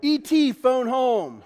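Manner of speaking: high, loud little-alien voice; movie alien impression. Preacher doing movie lines for the congregation, alien impression. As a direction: mimic a film alien, pushed up high.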